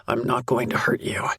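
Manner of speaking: low, rough man's voice eased a little; rugged male voice, softened a sliver. A narrator's reading. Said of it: "That rugged voice softens only a sliver".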